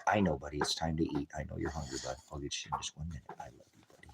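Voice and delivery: soft, hushed and tender; tender to dog. Real, not acted. Comforting his hungry dog, soft and tender. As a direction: soothe the hungry dog gently.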